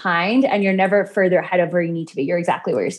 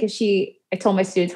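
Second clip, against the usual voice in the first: normal talking voice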